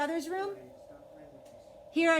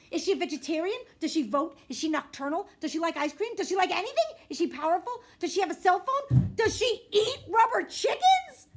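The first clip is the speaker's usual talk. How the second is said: higher, louder rapid-fire questions; eager questions; 9 semitones higher, 8 dB louder than usual. Acted. Little sister quizzing her siblings, bursting with excitement. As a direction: pepper them with excited questions.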